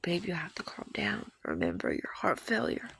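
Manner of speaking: gently retold plea to calm down; imitated soothing. Retold partner calming her down.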